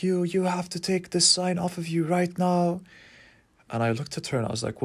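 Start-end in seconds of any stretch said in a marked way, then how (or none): 0.0-2.8 higher, pinched mock voice of a bossy old teacher; imitating the stern teacher. Meditation teacher scolding the speaker, higher mock bossy voice. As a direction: play the scolding teacher, pitched well up. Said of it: "she pointed her finger and she's like".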